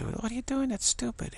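very high mock kid voice; exasperated kid. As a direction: play a child baffled by silly grown-ups. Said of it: "'Cause kids say".

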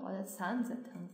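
normal talking voice

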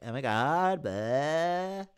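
mocking Californian drawl, swooping upward; Southern California accent. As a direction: imitate a Californian whine with long rising vowels. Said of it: "That's the accent".

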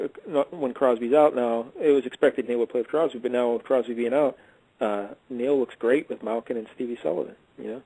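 ordinary delivery

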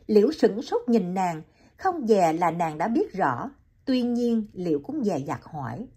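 plain reading voice

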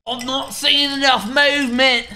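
complaining in a mock British accent. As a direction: put on a loud British accent. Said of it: "give Ninja a British accent".

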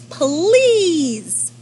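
high, loud, drawn-out plea; pleading lemur. Acted. Upside-down lemur says the magic word, high pleading. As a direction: stretch it into a high, eager plea.

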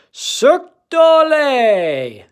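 high, loud, drawn-out holler; big storybook cheer, stretched long; excited shout. A narrator's reading. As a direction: shout it out slowly like a ringmaster's cry. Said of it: "shouted his amigos".

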